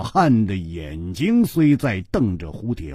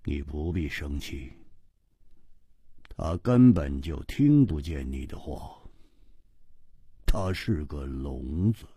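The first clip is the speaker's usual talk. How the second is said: low, soft, unhurried reply; cool, indifferent; 10 semitones lower, 7 dB quieter than usual. Acted. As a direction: speak quietly and coolly, voice dropped low. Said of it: "淡淡的说".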